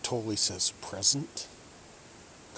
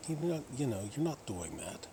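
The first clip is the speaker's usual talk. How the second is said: ordinary talk, nothing marked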